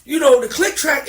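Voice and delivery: ordinary talk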